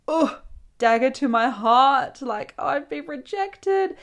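theatrical wounded lament; melodramatic hurt. Retold or imitated. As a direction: wail it slowly, hamming up the wounded pride. Retells being rejected by ex.

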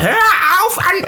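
imitating a boyfriend's high shrieking outburst; retold freak-out yell. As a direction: squeal it like a boyfriend losing it. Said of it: "dann hat er einen Schreikrampf bekommen".

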